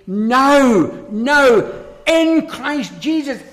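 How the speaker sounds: NOW, NOW punched loud and drawn out; very emphatic. Real, not acted. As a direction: hit each "now" hard, then stretch "in Christ Jesus". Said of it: "this is very emphatic".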